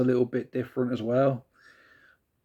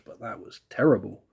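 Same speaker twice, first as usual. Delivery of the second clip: usual speaking voice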